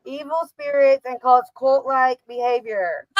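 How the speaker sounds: slow, mock-ominous reading; spooky label read. Shown as a demonstration. Friend reads shirt slogan aloud, slow and ominous. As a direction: read the warning like a horror trailer. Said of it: "It says".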